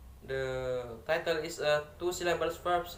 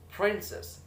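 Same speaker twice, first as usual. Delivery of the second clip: PRIN of "princess" pitched high, then falling; first-syllable stress; 5 semitones higher than usual. Shown as a demonstration. Student repeating "princess" to show first-syllable stress. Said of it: "in the first".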